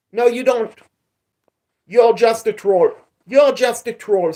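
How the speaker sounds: loud firm rebuke to a troll; angry scolding. Real, not acted. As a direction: shut the troll down hard.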